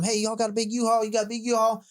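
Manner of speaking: high-pitched imitation of a phone inquiry; agent calling around. Agent phoning rental places, retold.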